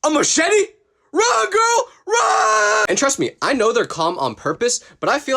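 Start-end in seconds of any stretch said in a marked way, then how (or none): 0.0-2.8 high, loud yell urging her to run; frantic shouting. Pretend. Imagined operator yelling at the chased woman, frantic shout. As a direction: scream the warning in mock panic. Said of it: "I would be like".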